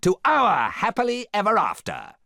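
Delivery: high, loud, grandiose shout; theatrical villain's battle cry; rallying cry. A narrator's reading. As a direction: proclaim victory to the troops. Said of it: "he cried".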